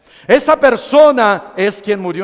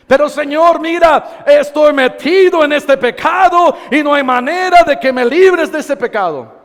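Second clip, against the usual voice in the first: pleading sinner; 3 semitones higher than usual. Acted. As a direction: cry out to the Lord as a desperate sinner.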